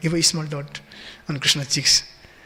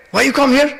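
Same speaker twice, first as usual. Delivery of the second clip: higher-pitched, mock-rude challenge; rude demand; 7 semitones higher, 4 dB louder than usual. Little Krishna questioning the elderly gopi, rudely. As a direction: put it as a blunt child's challenge, pitched up. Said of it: "like, so rudely".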